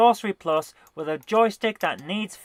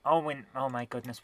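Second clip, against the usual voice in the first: ordinary delivery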